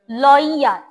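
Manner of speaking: "লয়ার" said as "loi-yar" with a drawn-out y; emphasized y sound. Shown as a demonstration. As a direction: stretch the y glide in "লয়ার". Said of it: "ওয়াই এর এখানে রস্যইটা একটু বেশি emphasise".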